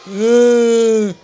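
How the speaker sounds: loud, long fake wail; mock crying. Whole class crying for a friend, loud mock wail. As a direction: bawl in one hammed-up howl.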